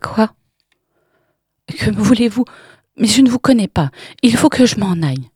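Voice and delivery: panicked pleading. Acted. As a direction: push past her in fear. Irène panicking, trying to escape.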